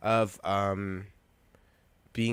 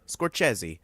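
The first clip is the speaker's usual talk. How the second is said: ordinary talk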